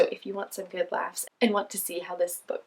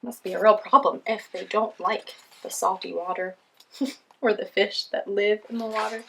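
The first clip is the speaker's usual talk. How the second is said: ordinary delivery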